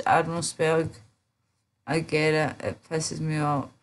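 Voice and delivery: ordinary talk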